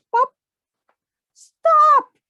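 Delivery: high, loud shout. Acted. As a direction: yell it at the squirrels with all your might. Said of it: "Pinky shouted".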